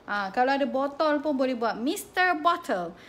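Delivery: ordinary delivery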